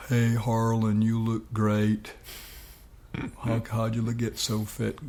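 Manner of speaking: slow [name] impression in a Gainesville accent; rough attempt at a Gainesville accent. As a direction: stretch the words out in a Gainesville accent. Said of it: "best I can do is my Gainesville accent".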